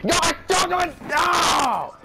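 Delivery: high, yelled alarm; panicked shout. Real, not acted. Gamer yelling as his team collapses, panicked shout. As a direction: shout in panic as it falls apart.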